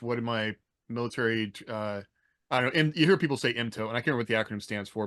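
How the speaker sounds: usual speaking voice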